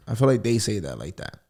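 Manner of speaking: ordinary talk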